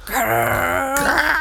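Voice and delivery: playful little growl; mock creature growl. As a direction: growl like a small monster. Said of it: "creatures that came out and went".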